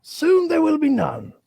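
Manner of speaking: mock-tragic museum narrator; mock zoo announcer. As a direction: do a melodramatic exhibit guide.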